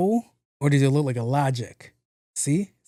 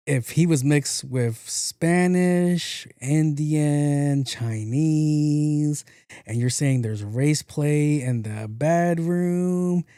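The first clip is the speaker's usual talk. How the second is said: slow emphatic list; much slower than usual. Real, not acted.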